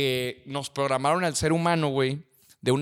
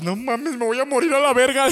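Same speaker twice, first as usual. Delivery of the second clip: higher, hammed-up dread of dying from the cold; mock freezing panic; 7 semitones higher, 4 dB louder than usual. Imagining someone in icy water, higher, mock panic. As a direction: act like you're sure the cold will kill you.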